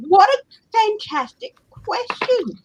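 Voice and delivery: high, slow, thrilled praise; slow and high, loving the question; delighted. Real, not acted.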